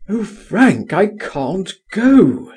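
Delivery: high, drawn-out plea; crying out. A narrator's reading. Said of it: "he cried".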